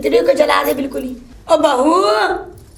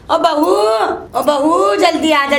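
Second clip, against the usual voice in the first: calling out; 5 semitones higher than usual. Acted. Calling bahu loudly.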